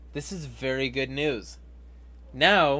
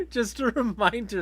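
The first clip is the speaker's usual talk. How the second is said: laughing; 11 semitones higher than usual. Real, not acted. Laughing about the news.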